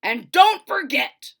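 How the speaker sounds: loud, slow call over shoulder; calling back. Acted. Father calling back while leaving, loud and slow. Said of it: "he called back".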